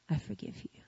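soft, hushed, tender; husband quietly forgiving his wife, tender hush; tender forgiveness. Retold or imitated. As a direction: say it gently as you hold her hand. Husband forgives her, holding hand.

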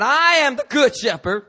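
high, ringing declaration; loud proclamation. Acted. Preacher quoting Jesus to the congregation, loud proclamation. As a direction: proclaim it to the whole room.

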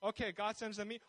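ordinary talk, nothing marked

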